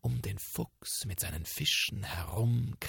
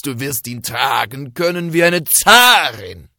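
loud, slow, excited shouting from far off; calling from afar; 7 semitones higher, 15 dB louder than usual. Acted. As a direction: call out to his wife across the yard. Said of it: "da rief er schon von weitem".

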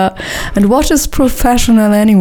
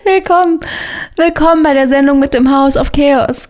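excited welcome; 6 semitones higher than usual. Acted. Restarting the show intro.

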